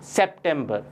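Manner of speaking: सितंबर as "sep-TEM-ber", with a p; English pronunciation. Shown as a demonstration. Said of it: "English में हम कहते हैं".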